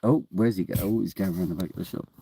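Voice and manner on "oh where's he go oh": ordinary delivery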